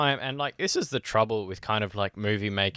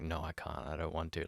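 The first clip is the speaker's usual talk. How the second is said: low, much softer retold refusal; reluctant refusal; 4 semitones lower, 12 dB quieter than usual. Podcaster retelling a character refusing to return, quietly reluctant. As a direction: imitate someone backing out, quietly and low.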